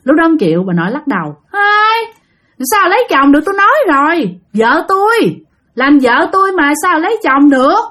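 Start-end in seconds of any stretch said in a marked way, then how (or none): 1.5-7.8 high-pitched, defiant spirit voice; jealous ghost protesting in a high wail; possessed grandmother refusing. Retold or imitated. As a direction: refuse stubbornly, pitched well above your own voice. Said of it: "Nói vậy đó các bạn".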